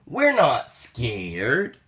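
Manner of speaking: usual speaking voice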